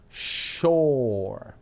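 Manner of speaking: SHORE held on a long O; O larga. Shown as a demonstration. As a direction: draw "Shore" right out on the vowel. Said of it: "Es una O larga". ("Shore" con una O larga y sostenida)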